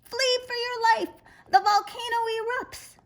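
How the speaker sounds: boy yelling a fake volcano warning; shouting. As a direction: sound the alarm as loud as you can. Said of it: "Then he shouted".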